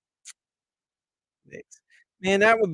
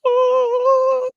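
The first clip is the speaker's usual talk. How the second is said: high, loud awed oh; a squealed amazed oh; awed gasp; 8 dB louder than usual. Pretend. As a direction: gasp as if seeing something holy. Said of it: "he's gonna be like".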